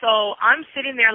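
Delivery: normal talking voice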